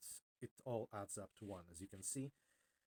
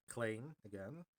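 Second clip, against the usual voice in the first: usual speaking voice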